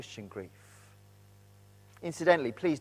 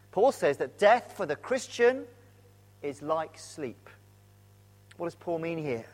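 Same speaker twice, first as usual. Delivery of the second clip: normal talking voice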